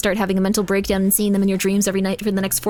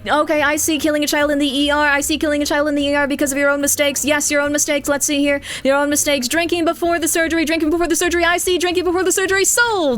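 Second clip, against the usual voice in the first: auctioneer voice; 4 semitones higher than usual. Pretend. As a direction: rattle it off like an auction. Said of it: "Did you just go full auctioneer voice?".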